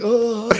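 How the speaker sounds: breathy falsetto moan; high moan. Retold or imitated. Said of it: "where I'm like".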